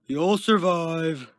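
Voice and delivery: loud, slow, drawn-out holler; dismissive call. A narrator's reading. Guard brushes Kenton off, calling back.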